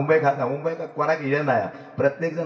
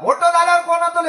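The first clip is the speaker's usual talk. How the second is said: teacher's question; 12 semitones higher, 6 dB louder than usual. Retold or imitated. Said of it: "काय म्हणालो".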